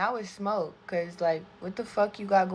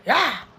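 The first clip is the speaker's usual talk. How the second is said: mock yelp; 5 semitones higher, 8 dB louder than usual. Mock panic yell.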